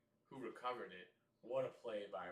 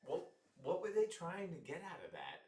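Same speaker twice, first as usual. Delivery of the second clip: ordinary delivery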